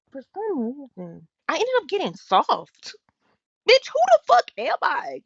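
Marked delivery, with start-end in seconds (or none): 3.7-5.2 sudden high, loud cry at herself; shocked shout. Real, not acted. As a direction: shout it in disbelief at yourself. Scolding herself for getting soft.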